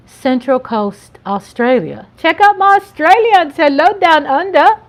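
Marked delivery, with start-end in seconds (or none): 2.2-4.8 high, loud, bad Australian accent; hammed-up, badly faked Australian accent; terrible Australian accent. Vlogger greeting Australians, terrible Australian accent. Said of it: "that was a terrible accent".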